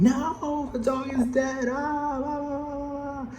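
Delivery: very high, wailing fake hysteria; mock hysterical wail. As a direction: wail it like panicking fans. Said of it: "everyone going".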